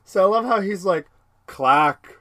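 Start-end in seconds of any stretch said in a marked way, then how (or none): 1.5-2.2 mock crab claw clack. Drugged Zoidberg clacking his claws, mock crab.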